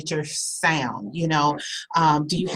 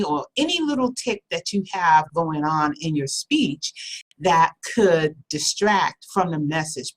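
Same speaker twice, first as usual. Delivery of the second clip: ordinary delivery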